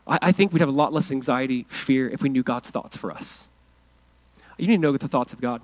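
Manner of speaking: ordinary delivery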